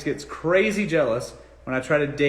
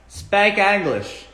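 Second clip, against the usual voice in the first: slow, louder mock hillbilly "Speak English"; 6 semitones higher, 5 dB louder, much slower than usual. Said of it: "I'm a hillbilly out here, redneck".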